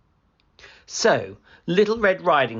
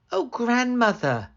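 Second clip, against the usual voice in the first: put-on higher, wondering girl; curious little girl; 12 semitones higher than usual. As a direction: marvel innocently.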